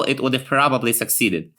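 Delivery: ordinary talk, nothing marked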